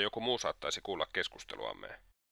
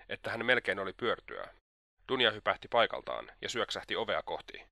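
ordinary delivery

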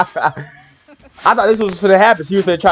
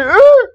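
startled grunt; 6 dB louder than usual. Retold or imitated.

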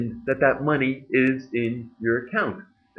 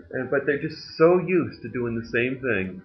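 normal talking voice

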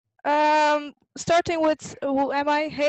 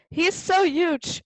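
higher, wide-eyed little bunny; amazed Miffy; 6 semitones higher, 5 dB louder than usual. Acted. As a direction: marvel like a small child. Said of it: "said Miffy".

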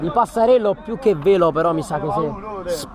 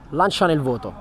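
usual speaking voice

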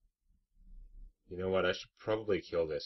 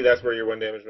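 frustrated; 4 semitones higher, 10 dB louder than usual. Real, not acted. Frustrated at card result.

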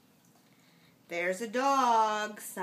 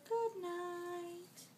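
soft, higher Barney good night; 6 semitones higher, 8 dB quieter than usual. Acted. Parent ending bedtime story for child, soft Barney voice. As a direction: tuck in softly as Barney. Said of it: "Barney says".

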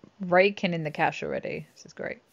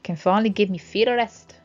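louder mock-Scottish tavern keeper; put-on Scottish accent; 3 semitones higher, 7 dB louder than usual. Tavern keeper finally resting their feet, mock Scottish accent. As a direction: lapse into a playful Scottish accent. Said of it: "I have to go, like, all Scottish".

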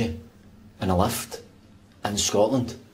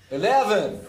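higher, badly faked American accent; attempted American accent; 8 semitones higher than usual. Scotsman insisting to his friend it's American, hammed-up bad American accent. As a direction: have another go at a poor American accent. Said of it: "You need to try an American accent".

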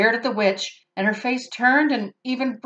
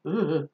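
disgusted ugh; 4 semitones lower, 4 dB quieter than usual. Real, not acted. Reader disgusted aside.

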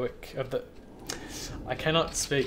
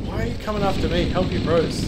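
exasperated; 5 semitones higher, 5 dB louder than usual. Real, not acted. Allies attacking him in game.

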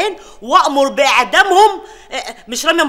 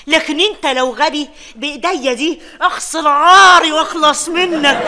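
ordinary talk

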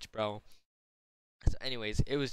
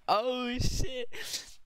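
shocked crowd; 14 semitones higher than usual. Retold or imitated. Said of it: "Everyone was like".

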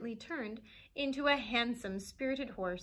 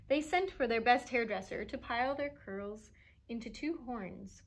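usual speaking voice

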